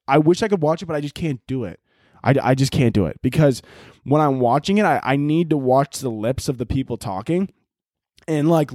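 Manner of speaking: ordinary talk, nothing marked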